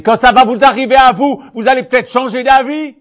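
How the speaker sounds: high, loud, fiery warning; a preacher raising his voice against the comfortable; loud preaching warning. Acted. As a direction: thunder it at the complacent. Preacher warns scoffers.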